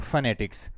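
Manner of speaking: फोनेटिक्स spoken with a falling end, short e in the middle; model pronunciation. Shown as a demonstration. Teacher answering his own question, clear model of फोनेटिक्स. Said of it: "इसका pronunciation क्या होता है?".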